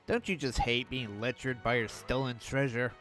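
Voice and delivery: usual speaking voice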